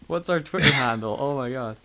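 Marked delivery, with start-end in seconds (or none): none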